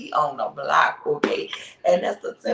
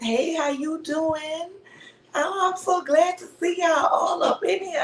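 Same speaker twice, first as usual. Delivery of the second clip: excited; 10 semitones higher than usual. Real, not acted. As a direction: burst out with joy. Greeting viewers joyfully.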